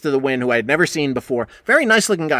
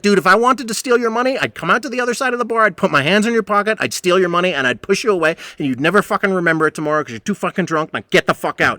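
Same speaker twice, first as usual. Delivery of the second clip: angry shouting; 6 semitones higher than usual. Retold or imitated. Bartender yelling at drunk.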